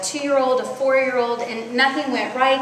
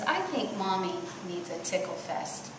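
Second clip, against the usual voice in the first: very soft, lower, gentle voice; tender husband; 4 semitones lower, 12 dB quieter than usual. Retold or imitated. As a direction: speak softly, as a quiet, sweet man. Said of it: "And very tenderly".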